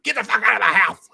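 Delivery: high, loud cartoon-kid outrage; Cartman. Friend imitating Andrew kicking him out, Cartman yell. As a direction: squawk it in a mock cartoon voice. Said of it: "That's what he sounded like".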